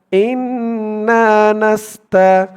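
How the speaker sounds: ইন্না নাস্তা drawn out slowly; slow recitation. Shown as a demonstration. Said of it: "যেমন টান করব". (ইন্না নাস্তা ধীরে তিলাওয়াত)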